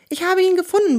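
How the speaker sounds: quick report. A narrator's reading. Kunibert found the dog, an eager quick report. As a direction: blurt out the good news. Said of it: "berichtet Kunibert schnell".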